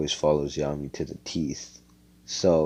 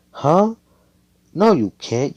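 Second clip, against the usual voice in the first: shocked protest; 11 semitones higher, 8 dB louder, slower than usual. Retold or imitated. Girl protesting, retold argument.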